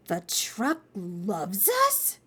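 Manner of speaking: slow, wondering question; awed disbelief. Acted. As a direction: ask it in amazement, drawing out each word. Child awed by truck's message.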